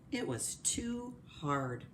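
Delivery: plain reading voice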